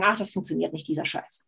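leiser, abfällig hingehaucht; frustriert gemurmelt. Retold or imitated. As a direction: murmle den Frust weg. Imitierter Frust nach Scheitern. (leise, abfällig, fast geflüstert)

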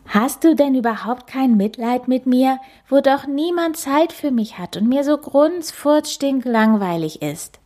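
high whiny pleading; child begging. Acted. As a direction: whine for pity. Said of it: "quengelt der kleine Nasenbär".

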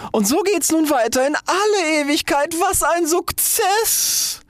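very high, straining crow of success; ecstatic triumph. A narrator's reading. Rocket revelling in flight, high triumphant cry. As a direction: crow it high and stretch the last words.

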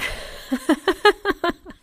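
high witchy cackle; a witch laughing at her friend's timid wish. Acted. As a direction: cackle like a witch amused by a newcomer.

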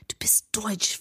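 hushed, conspiratorial whisper. A narrator's reading. Narrator to Nancy at the pool, whispered. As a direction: breathe it under your voice. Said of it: "flüstere ich".

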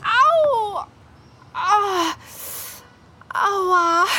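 loud high cries of pain. Acted. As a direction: yelp as she falls. Falls and scrapes her knee. (laute Schmerzensschreie)

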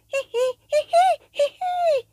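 squeaky rising giggle; very high giggle. Acted. (sehr hohes Kichern)

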